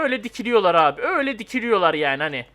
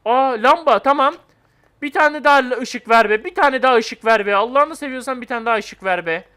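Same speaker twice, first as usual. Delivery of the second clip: excited pleading; 5 semitones higher, 6 dB louder than usual. Real, not acted. Player begging the game for more light, loud pleading. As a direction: beg the game out loud, half laughing.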